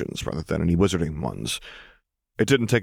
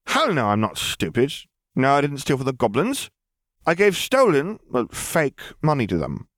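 a touch higher, breezy and scoffing; a lightly voiced young girl; 7 semitones higher than usual. A narrator's reading. Lily voice again.

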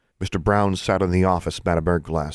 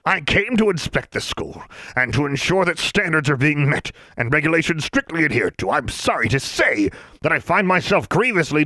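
pompous official; 9 semitones higher than usual. Acted. Inspector Brown rebukes the headmistress for laxity, pompous and high. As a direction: lecture her like a disappointed bureaucrat.

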